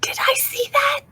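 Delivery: high, breathless whispered disbelief; real stunned whisper at the table. Real, not acted. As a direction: gasp it out in disbelief. Player reacts to teleport, whispered.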